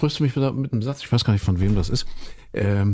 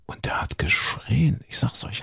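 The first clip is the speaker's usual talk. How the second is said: whispering; 4 dB quieter, slower than usual. Real, not acted. Dramatic telling about the cat.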